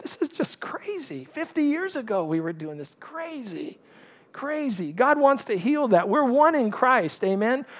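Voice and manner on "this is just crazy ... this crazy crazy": much softer, almost breathed-out inner thought; hushed disbelief. Retold or imitated. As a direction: say it under your breath, stunned. Inner thought while watching injustice.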